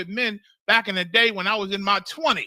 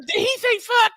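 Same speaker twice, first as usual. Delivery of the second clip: shocked falsetto; 5 dB louder than usual. Retold or imitated. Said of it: "He said".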